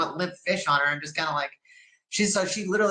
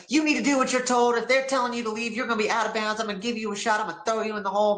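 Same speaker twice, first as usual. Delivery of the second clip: angry lieutenant; 5 semitones higher, faster than usual. Retold or imitated. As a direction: bark the orders down at her. Angry lieutenant berating inmate.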